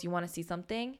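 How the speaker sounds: put-on soft, hushed kid's aside; hushed, keeping it from the lady next to them; retold whisper. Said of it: "we're, like, whispering".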